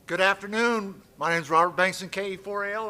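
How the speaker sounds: drill sergeant voice. Pretend. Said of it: "You wanna see my drill sergeant voice?".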